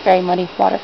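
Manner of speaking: soft, hushed documentary narration; nature documentary. Pretend. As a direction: present the mud like a wildlife host.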